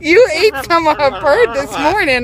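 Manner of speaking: loud laughing words. Real, not acted. Cracking up at the parrot.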